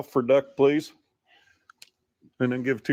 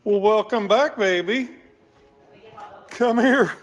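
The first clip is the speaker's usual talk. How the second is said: excited welcome; 7 semitones higher than usual. Real, not acted. Greeting returned lost dog.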